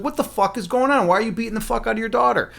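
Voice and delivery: high, outraged demand; indignant confrontation. Acted. Queen confronting a mother beating her daughter, indignant.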